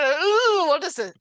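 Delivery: high, loud startled ooh at a bite; a sudden squeal of disgust at the bug; surprised ooh. Pretend. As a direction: act shocked at what you bit into. Biting a wormy apple.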